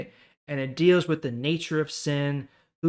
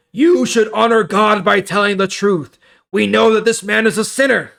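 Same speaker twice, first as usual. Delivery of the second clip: louder, higher, pressing; stern accusing leaders; 8 semitones higher, 13 dB louder than usual. Acted. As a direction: lean on him hard.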